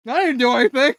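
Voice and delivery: high, loud, put-on baffled voice; puzzled protest. Melia confused that her kick did nothing, mock bafflement.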